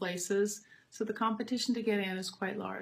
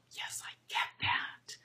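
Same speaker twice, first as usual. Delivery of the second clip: put-on breathy whispered little-girl voice, higher at the start; whispered girl's reply; 5 dB quieter than usual. Young student whispering her reply to the voice teacher.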